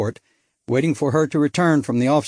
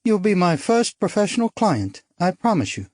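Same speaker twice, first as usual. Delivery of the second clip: put-on lighter, higher bright young woman; spirited society girl, higher; young woman character; 6 semitones higher than usual. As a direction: play her as a bold upper-class girl. Narrator voices young woman.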